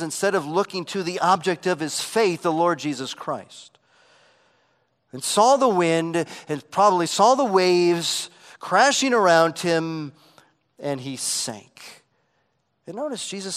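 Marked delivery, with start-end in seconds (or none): none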